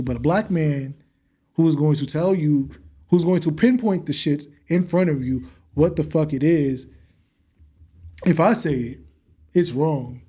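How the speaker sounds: ordinary talk, nothing marked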